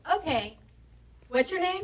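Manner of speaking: imitated receptionist, high and loud; assistant on phone. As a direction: play a chirpy, oblivious phone assistant. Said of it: "his assistant answers the phone".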